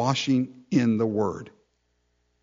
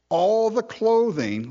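high, slow emphasis; 8 semitones higher than usual. Acted. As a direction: lift all the clothing high and slow it down. Preacher lifts phrase, then repeats it.

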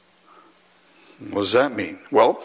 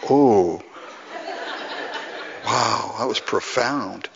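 mock awe; 2 semitones lower, 4 dB quieter, slower than usual. Listener reacting to a milkshake remark, mock awe. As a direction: pretend to be deeply impressed. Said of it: "and I'd be like".

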